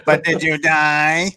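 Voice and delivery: slow whiny drawn-out question. Shown as a demonstration. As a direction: whine it like a sore loser. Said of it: "You gotta say that with a more whiny voice".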